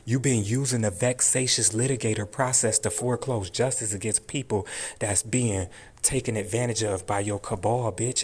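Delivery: normal talking voice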